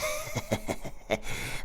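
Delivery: sudden very high squeaky laugh; high giggle. Acted. Giddy laugh.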